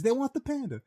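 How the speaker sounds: mock the carnie begging like a kid; whining carnie. Carnie pleading with the narrator as he walks away, high whine. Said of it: "he says".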